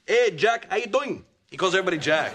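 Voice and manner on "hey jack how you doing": put-on high cocky Rico-style accent; Rico accent impression. Husband doing Rico's accent for his wife, high and cocky. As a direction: greet like the swaggering new kid. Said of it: "he's got that accent".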